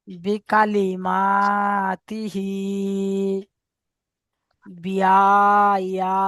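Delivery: very slow recitation model, each word drawn out and set apart; slow model reading. Shown as a demonstration. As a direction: recite each word slowly with long pauses for students to copy. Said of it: "আমি এখন reading বলে দিচ্ছি". (ধীরে ধীরে টেনে তিলাওয়াতের নমুনা পড়া)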